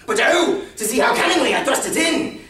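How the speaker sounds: high, loud manic exclamation; exultant madman's outburst; gleeful boasting. Acted. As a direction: cry out, revelling in your cunning. Madman revels in his cunning.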